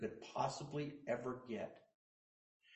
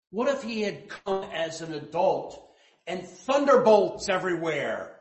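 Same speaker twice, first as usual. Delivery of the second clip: louder and higher, thundering; thunderous booming preaching, louder and higher; 6 semitones higher, 11 dB louder than usual. Real, not acted. Preacher depicting thunderous God.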